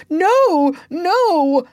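high, loud, terrified refusal; cowering cry. A narrator's reading. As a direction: cry out in dread of the captain. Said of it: "cowered before his captain".

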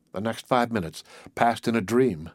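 ordinary delivery, nothing marked

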